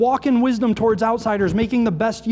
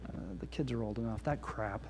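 much softer, hushed mock lie; enemy's whisper; 10 semitones lower, 17 dB quieter than usual. Preacher mimics the enemy's lie, much softer and hushed. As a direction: murmur it like a sly temptation. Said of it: "let the enemy whisper that".

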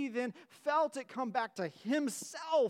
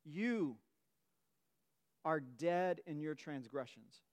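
slow, low, quiet scripture reading; hushed solemn recitation; 5 semitones lower, 3 dB quieter, slower than usual. Shown as a demonstration. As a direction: let each word land gravely. Preacher reads scripture slowly, solemnly.

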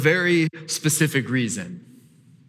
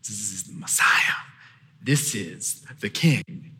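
hushed awe; 9 semitones lower, 5 dB quieter than usual. Real, not acted. Reverent hushed proclamation.

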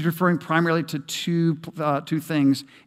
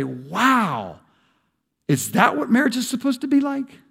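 amazed onlooker; 8 semitones higher than usual. Retold or imitated. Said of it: "they ought to say".

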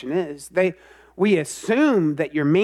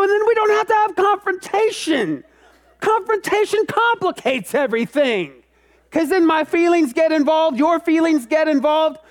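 much higher and louder, a whiny exasperated near-shout; exasperated outburst; 11 semitones higher, 4 dB louder than usual. Acted. Pastor voicing a conflict-avoider's frustration to the congregation, exasperated high shout. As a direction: throw up your hands and plead, pitched way up.

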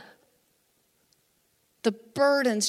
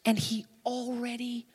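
tender assurance; 4 semitones lower than usual. Real, not acted. Preacher turns tenderly to congregation.

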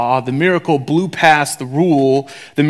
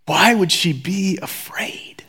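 hushed aside; quiet unvoiced whisper; 4 semitones higher, 4 dB quieter, slower than usual. Real, not acted. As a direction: whisper the question to the room.

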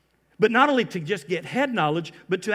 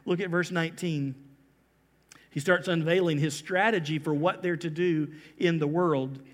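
ordinary talk, nothing marked

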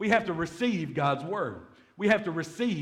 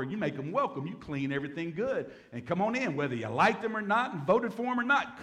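ordinary talk

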